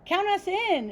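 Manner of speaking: high, loud, eager cry; excited exclaim. Acted. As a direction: burst in with excitement. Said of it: "exclaimed seven".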